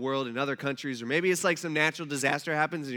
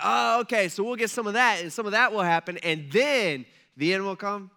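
higher, louder dawning understanding; mock eager disciples; 3 semitones higher, 5 dB louder than usual. Disciples asking Jesus about the end, mock eager questioning. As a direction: imitate hopeful followers checking the plan.

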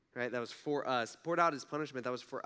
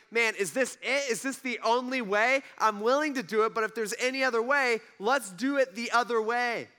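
raised, urgent plea to the Father; Jesus pleading in the garden; 8 semitones higher, 7 dB louder than usual. Retold or imitated. Jesus begs God in the garden, loud anguished plea. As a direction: cry out to be spared, loud and higher. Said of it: "he's like".

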